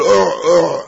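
short throaty "أع، أع" with a u vowel; imitated siwak gagging sound. Teacher explains the sound of the siwak, throaty uʕ-uʕ imitation. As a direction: imitate a siwak sound, two tight uʕ bursts. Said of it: "يعني أن صوت السواك".

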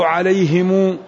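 عليهم said "alayhimuu", long u added after the m; lengthened mim. Shown as a demonstration. Teacher shows a third variant, عليهم as alayhimuu. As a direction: draw the ending out into a long -muu: alayhimuu. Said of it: "المعنى لا يتغير".

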